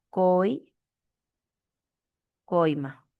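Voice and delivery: "coi-" drawn out, long pause, then "coima"; broken into syllables. Shown as a demonstration. Teacher isolating the diphthong in "coima", slow and broken up. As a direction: hold "coi-" slowly and stop before finishing "coima".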